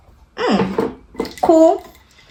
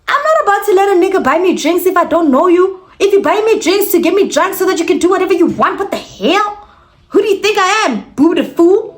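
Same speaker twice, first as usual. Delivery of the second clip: indignant rant; 7 semitones higher than usual. Real, not acted. Indignant rant at the drink offer.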